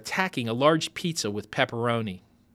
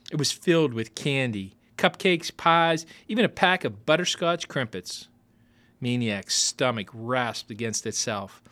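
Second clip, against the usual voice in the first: plain reading voice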